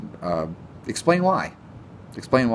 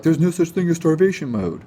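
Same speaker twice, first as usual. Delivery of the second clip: higher mocking voice of a know-it-all; mocking quote; 8 semitones higher, 3 dB louder than usual. Vlogger imitating diet critics, higher mocking voice. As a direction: parrot them in a snooty higher tone. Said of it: "people are like".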